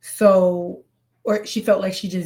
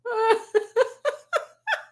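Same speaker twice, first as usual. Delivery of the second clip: very high peals of giggles; helpless laughter. Real, not acted. Reactor cannot take the clip seriously, helpless laughter. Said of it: "I can't take anything serious".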